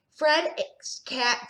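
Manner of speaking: plain reading voice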